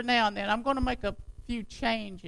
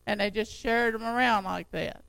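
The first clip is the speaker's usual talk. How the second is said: normal talking voice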